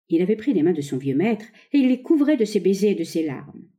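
plain reading voice

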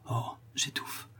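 gasping breathless whisper; choking whisper. Acted. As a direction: struggle for air on it. Dying man gasps for air.

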